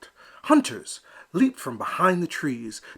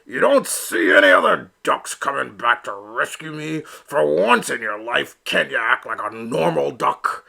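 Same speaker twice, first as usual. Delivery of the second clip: louder, exasperated papa voice; a father scolding his son, raised voice; arguing; 5 dB louder than usual. Acted. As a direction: push back hard on the son. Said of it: "he argued".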